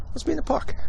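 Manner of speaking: high, soft mocking echo; mocking imitation. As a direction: mimic his line in a high sneer.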